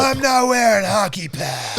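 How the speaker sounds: slow, laughing Batman growl; husky movie Batman line. Retold or imitated. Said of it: "do you prefer that or do you prefer".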